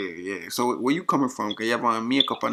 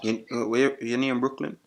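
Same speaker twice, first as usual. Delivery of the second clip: thick Jamaican patois; put-on Jamaican accent; 3 semitones higher, 7 dB louder than usual. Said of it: "used my Jamaican accent".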